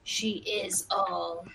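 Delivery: slow, grand announcer echo; semi-epic weird voice. Acted. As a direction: intone it like an epic narrator. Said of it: "repeat everything you say in a semi-epic, weird voice".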